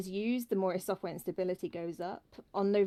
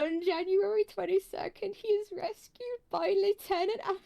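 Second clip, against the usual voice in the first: rereading through giggles, pitched up; practicing a speech; 12 semitones higher, 5 dB louder than usual. Real, not acted. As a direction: try the line again, half laughing. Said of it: "Okay, let me try that again".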